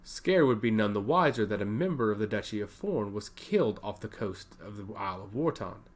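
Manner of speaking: plain reading voice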